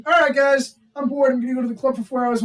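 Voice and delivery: bar entrance announcement. Acted. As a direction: announce it to the whole room.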